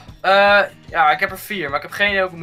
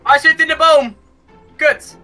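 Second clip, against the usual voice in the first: frustrated shout; 9 semitones higher, 5 dB louder, slower than usual. Real, not acted. Frustrated calling out in the game.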